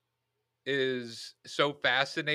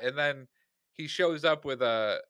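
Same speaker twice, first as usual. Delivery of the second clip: ordinary talk, nothing marked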